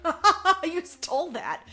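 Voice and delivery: high loud sarcastic ha ha ha; mocking laugh. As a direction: taunt him with a sarcastic cackle. Mock taunt of German guy.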